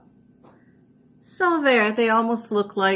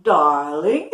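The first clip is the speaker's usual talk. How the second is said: mock Audrey Hepburn accent; 5 semitones lower, 6 dB louder than usual. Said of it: "she had that different kind of accent".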